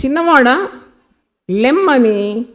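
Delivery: loud, ringing order to rise; commanding. Acted. Preacher voicing Jesus to the dead young man, loud command. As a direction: call it out with full authority. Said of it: "ఆజ్ఞాపించేవాడు అన్నమాట".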